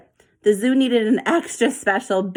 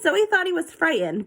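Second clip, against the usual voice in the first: plain reading voice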